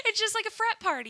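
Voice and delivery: high laughing words. Real, not acted. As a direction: laugh through the line. Laughing at silly plot.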